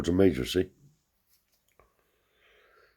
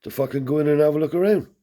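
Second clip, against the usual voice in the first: fast, higher and louder blustering boss voice; Graham's way of talking; 11 semitones higher, 6 dB louder, faster than usual. Retold or imitated. Teller imitating the yard owner giving orders, fast, high and loud. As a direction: rattle it off like a pushy yard boss, loud and quick. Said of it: "That's how he spoke".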